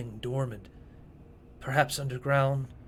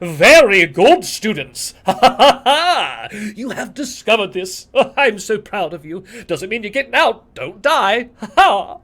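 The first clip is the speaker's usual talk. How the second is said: booming, higher, much louder villain voice, laughing; booming arrogant alien overlord; 7 semitones higher, 12 dB louder than usual. Acted. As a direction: gloat down at the students from above. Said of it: "booming voice".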